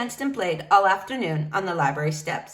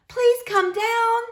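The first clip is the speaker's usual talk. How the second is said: much higher, slow plea; begging; 13 semitones higher, 4 dB louder, slower than usual. Acted. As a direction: beg her to come down. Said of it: "they begged".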